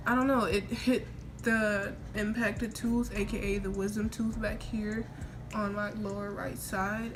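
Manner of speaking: ordinary talk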